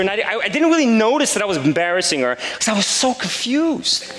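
usual speaking voice

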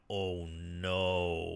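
low, soft, defeated oh no; dismayed groan. Retold or imitated. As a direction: sink at the news. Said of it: "and I'm like".

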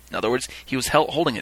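ordinary talk

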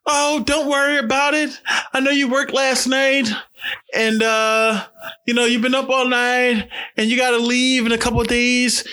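high-pitched put-on mom voice; high mock-mom voice letting someone off; imitating mom. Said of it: "she texted me".

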